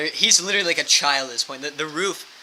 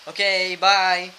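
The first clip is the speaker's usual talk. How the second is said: very drawn-out, louder singsong farewell; mock-casual goodbye; 4 semitones higher, 7 dB louder, much slower than usual. Friends humoring man fetching his gun, drawn-out goodbye. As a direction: stretch out the goodbye, humoring him.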